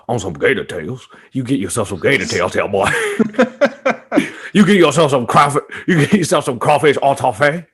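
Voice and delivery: a lightly voiced Southern New Orleans boy; New Orleans accent. Hyping gator tails to a friend in a mock New Orleans accent. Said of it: "I was going for a New Orleans accent".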